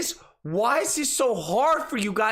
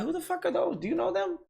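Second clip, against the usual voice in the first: high, softer, incredulous squeak; mock disbelief; 8 semitones higher, 4 dB quieter, faster than usual. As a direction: play baffled at strangers crashing the group. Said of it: "We're like".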